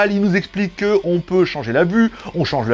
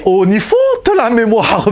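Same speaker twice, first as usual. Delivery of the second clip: hammed-up German accent, loud; 4 semitones higher, 6 dB louder than usual. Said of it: "je fais super bien l'accent allemand".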